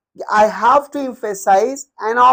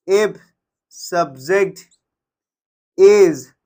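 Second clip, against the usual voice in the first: slow dictation with long pauses between words; spaced out, as if writing along; 4 semitones lower, 3 dB louder, much slower than usual. Shown as a demonstration. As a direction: dictate it one word at a time. Dictating words while writing them out.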